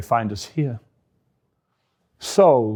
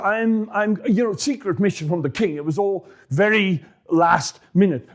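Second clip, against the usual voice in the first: nervous David; 6 semitones higher than usual. Acted. David lies hurriedly to priest.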